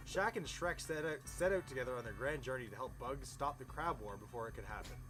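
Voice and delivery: ordinary talk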